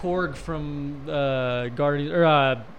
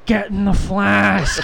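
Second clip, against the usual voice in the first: put-on African accent; 4 semitones higher, 6 dB louder, much slower than usual. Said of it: "give us an, an African accent".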